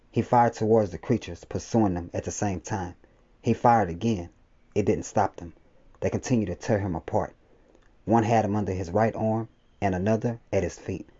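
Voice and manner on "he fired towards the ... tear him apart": ordinary delivery, nothing marked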